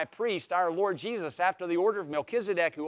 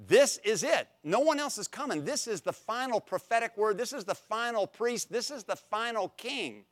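higher, urgent proclamation of this is it; preaching emphasis; 7 semitones higher than usual. Acted. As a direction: lift it up like a preacher. Preacher stressing finality of Christ.

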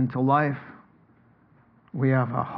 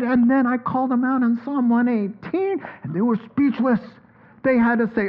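higher, eager retelling as the apostle Peter; excited Peter; 7 semitones higher than usual. Pretend. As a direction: relive the arrest wide-eyed and thrilled. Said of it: "pretend we're listening to Peter".

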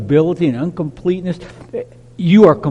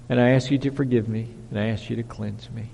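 quiet confession; 7 semitones lower, 9 dB quieter, faster than usual. Pretend. Confessing prayer to God.

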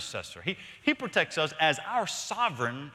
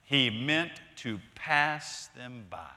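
very slow, deliberate reading; 2 semitones lower, slower than usual. Real, not acted. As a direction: linger on each word of the verse. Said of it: "Slow down".

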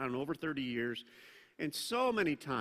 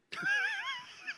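laugh. Real, not acted. Laugh burst after "be mad.

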